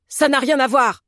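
indignant, grumbling retort; angry growl. A narrator's reading. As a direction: snap back with hurt pride. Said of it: "gronda l'accidenté sous son mouchoir".

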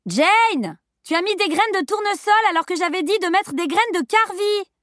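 very high, scolding call from another room; cried scolding. A narrator's reading. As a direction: cry out a reproach through the curtain. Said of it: "cria une voix de l'autre côté du rideau". (reproche crié d'une voix très aiguë)

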